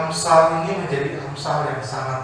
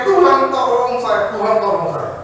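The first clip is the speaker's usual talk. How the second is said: mock cry for help; 6 semitones higher, 6 dB louder than usual. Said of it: "dia teriak".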